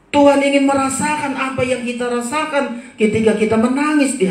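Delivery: high, fervent sermon voice; impassioned preaching. Real, not acted. Preacher urging congregation to weep at Tuhan's feet, impassioned preaching. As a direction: stretch the key words and press the appeal.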